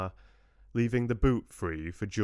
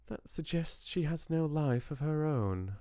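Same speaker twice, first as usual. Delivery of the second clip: drawled; 8 semitones higher than usual. Acted. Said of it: "she drawled".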